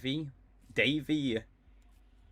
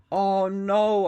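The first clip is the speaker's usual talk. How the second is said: loud, high oh no; dismayed cry; 8 semitones higher, 8 dB louder than usual. Real, not acted. Streamer crying out over his own mistake. Regret after missing a play.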